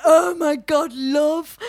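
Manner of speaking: mock teen angst. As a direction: ham up a lovesick teen's despair. Mocking teenage angst.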